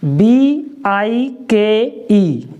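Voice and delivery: letters spelled slowly one by one; patient letter-by-letter spelling. Shown as a demonstration. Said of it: "इसको क्या पढ़ेंगे".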